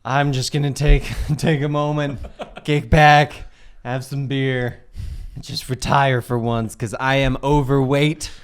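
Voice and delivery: slump into it like a spent hero; tired Blue Beetle. Acted. Blue Beetle bowing out to Doctor Fate, low and weary. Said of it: "Blue Beetle goes".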